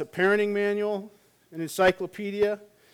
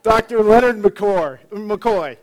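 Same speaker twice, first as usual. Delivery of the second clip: announcing McCoy; 3 semitones higher, 8 dB louder, slower than usual. Pretend. Announcing the character.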